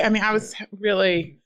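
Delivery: ordinary talk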